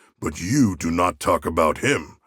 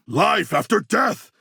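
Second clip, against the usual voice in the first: triumphant cry; 11 semitones higher than usual. Acted. Triumphant battle cry in game.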